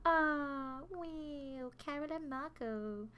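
a hammed-up fond sigh; mock sigh. Softly swooning over Carol and Marco's romance, mock sigh. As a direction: sigh over the lovers, half joking.

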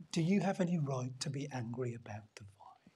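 whispered, tender question; gentle whisper. Acted. God quietly asks Jonah about the vine, whispering. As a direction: ask it in a hushed whisper.

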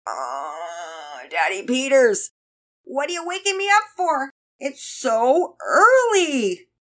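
put-on sleepy kid wailing in protest at being woken; groaning complaint. A narrator's reading.

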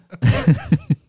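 giddy laugh. Real, not acted. Laughs while alone on mic.

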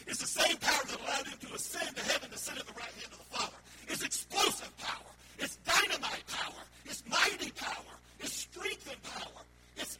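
high, loud, building sermon delivery; forceful, emphatic preacher; preaching at full force. Real, not acted. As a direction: hammer each phrase home from the pulpit.

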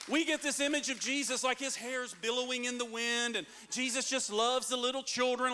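normal talking voice

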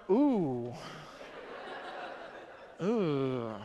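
low, soft mock ooh; imitating a flat ooh. Mimicking the silent congregation, a soft deflated ooh. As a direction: mimic the unimpressed crowd's ooh. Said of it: "you just sat there and went".

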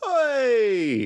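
high, long falling echo; drawn-out comic call; mock street shout. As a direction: wail it out in imitation. Co-host echoes the mock shout.

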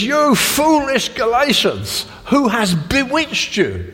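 high, slow, indignant rebuke; slow, pained scolding from an angry apostle; Paul thundering at the Galatians; furious Paul. Retold or imitated. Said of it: "Paul is absolutely furious".

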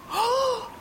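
a friend shrieks at a ghost on the waves; scared shriek; frightened cry. A narrator's reading. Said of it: "roept een van de vrienden".

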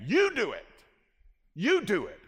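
"You" punched high and loud; emphatic YOU. Retold or imitated. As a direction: hit the word "You" hard, high above the rest. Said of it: "begins with an emphatic you".

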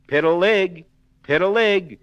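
high, loud sing-song calling; wolf calling. Acted. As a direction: coax the pig to come out. Wolf calls the pig.

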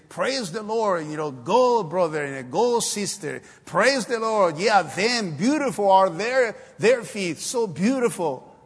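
hammed-up congregation sending someone off; mock praise. Churchgoers applaud those who go to the field, mock enthusiastic praise. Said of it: "And we praise them and say".

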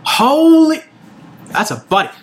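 shocked double take. Acted. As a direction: jolt in surprise then bark. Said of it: "He does a double take".

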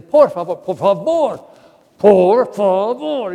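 loud, slowing pleas of por favor; loud imitated scolding nun; mock shouting. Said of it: "ella siempre estaba gritando".

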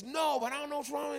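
high, fast, flustered reply; prophet. Acted. Prophet answers the donkey, high and flustered. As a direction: prophet blurting out in confusion. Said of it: "And he says".

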